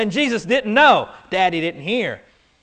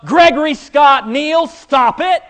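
raised parent voice, full name; yelling child's full name; 6 semitones higher, 5 dB louder than usual. Retold or imitated. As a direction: call out the full name sternly. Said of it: "The third word was".

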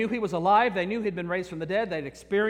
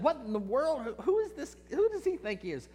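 softer, higher, puzzled grumble; indignant mutter; 4 semitones higher, 5 dB quieter, faster than usual. Acted. Fishermen muttering to each other about the stranger, softer and indignant. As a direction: disbelief kept under breath. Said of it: "looked at each other and said".

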